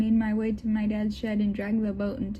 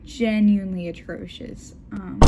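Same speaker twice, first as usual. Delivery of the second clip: plain reading voice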